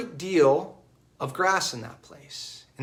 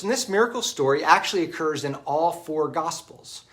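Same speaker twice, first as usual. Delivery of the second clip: normal talking voice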